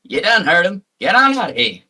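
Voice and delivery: loud, high southern farmer; southern accent. Acted. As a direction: bark it like an angry farm hand.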